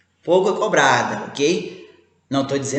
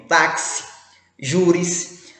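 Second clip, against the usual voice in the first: usual speaking voice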